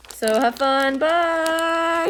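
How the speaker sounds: cheery high sign-off. Real, not acted.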